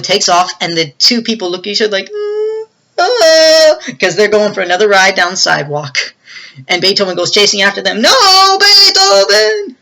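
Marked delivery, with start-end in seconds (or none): 2.1-3.7 high-pitched mock wail rising in volume; two victims' dawning panic as a wordless cry; imitated alarmed cries. Two people facing another ride, high mock wail. 8.0-9.8 high, loud, slow wail of protest; drawn-out shout. Retold or imitated. Owner calling after the chasing dog, long high yell.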